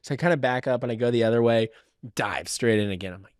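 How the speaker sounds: normal talking voice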